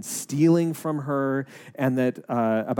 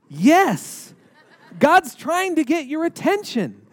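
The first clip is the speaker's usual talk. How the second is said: emphatic excited yes; 12 semitones higher, 5 dB louder, slower than usual. Retold or imitated. Retold urgent answer to woman.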